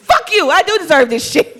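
loud high laughing retort; high loud defiant comeback with a laugh; defiant shout. Retold or imitated. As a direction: fire back at the doubt. Defiant reply to inner doubt.

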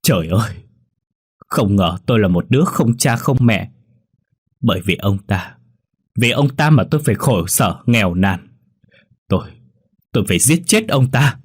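broken voice rising to a mild threat; a son shattered by the truth vows to kill; distraught and vengeful. Acted. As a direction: despair turning into vowing revenge. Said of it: "Hắn nghe xong thì suy sụp".

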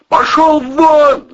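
high, loud, drawn-out command; angry yell. A narrator's reading. Man driving the monster from the door, yelling. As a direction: roar at the intruder to leave. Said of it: "заорал Волына".

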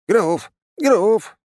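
very high, loud yelps; indignant treant child. A narrator's reading. Little treant protests being called crook, indignant. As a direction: yelp the name in high outrage. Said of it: "возмутился древень".

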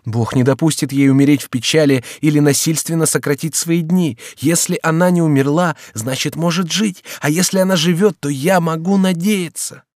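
exclaiming. A narrator's reading. Henri to the servant, vowing loudly to wait. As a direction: declare you'll wait, crying out. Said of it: "вскричал Генрих".

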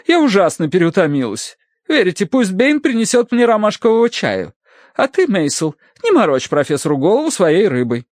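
high-pitched lady handing out orders; theatrical overtired matron. Acted. Aunt ordering tea and scolding husband, affected. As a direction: complain and command in a high matron's voice. Aunt's figure giving orders.

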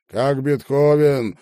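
loud, slow, stretched-out query; questioning drawl. A narrator's reading. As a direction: drag the words out questioningly, loudly. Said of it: "вопросительно протянул говно".

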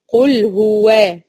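قل هو drawn out very slowly; slow recitation. Shown as a demonstration. Teacher isolating قل هو for students, slow recitation. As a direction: stretch قل هو slowly before الله. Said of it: "سبقت هنا بإيه".

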